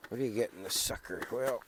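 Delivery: ordinary talk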